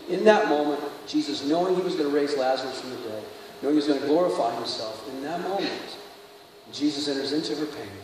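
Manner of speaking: low, very soft, solemn telling; hushed preaching. Real, not acted. Preacher tells of Jesus weeping with Mary, hushed. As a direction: let it sink to a hush between long pauses.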